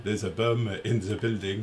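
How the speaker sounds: 'Allo 'Allo style mock French accent; comedy French accent. Host doing his hello hello bit, bad French accent. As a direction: mangle it in a hammed-up French accent. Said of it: "as good as my hello, hello one".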